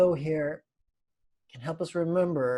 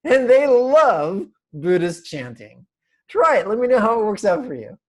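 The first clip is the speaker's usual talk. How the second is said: laughing; 6 semitones higher, 10 dB louder than usual. Real, not acted. Laughing through joke.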